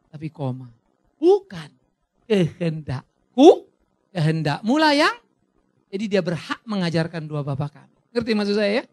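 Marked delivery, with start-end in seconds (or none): 1.2-5.2 pleading. Acted. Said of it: "Dia memohon".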